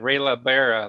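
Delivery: normal talking voice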